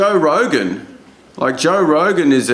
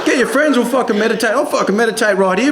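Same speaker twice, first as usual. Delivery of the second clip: high, eager stoner bro pitch; excited tripping bro; 7 semitones higher, faster than usual. Retold or imitated. Bar lout on mushrooms, giddy, urging his friends to meditate. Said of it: "he's on magic mushrooms".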